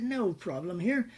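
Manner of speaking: slow, high Australian drawl; drawn-out mock-Australian reassurance; Australian businessman. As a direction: imitate an easygoing Aussie. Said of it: "Australian businessman".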